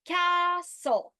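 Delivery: castle with CA high and loud; stress on CA. Shown as a demonstration. Teacher modeling the stressed first syllable of castle. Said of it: "The pitch is on ca".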